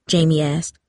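ordinary delivery, nothing marked

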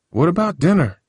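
high, indignant question; a kid objecting to missing dinner, lightly indignant; protesting. Acted. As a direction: push back, a mild teen protest. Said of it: "Lionel protested".

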